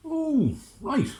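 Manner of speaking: very high, loud "oh right"; sceptical Lord Mayor. Acted. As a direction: squeak it out high and doubtful. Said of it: "said the Lord Mayor".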